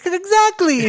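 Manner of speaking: very high, loud mock-villain cry; gleeful outburst. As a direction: shriek it in imitation of a delighted old villain. Acted gleeful reply of old villain.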